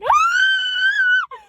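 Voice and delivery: long, very high, loud mock sob; exaggerated shrieking cry; mock crying wail. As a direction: imitate a sister bawling at full pitch. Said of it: "she's crying".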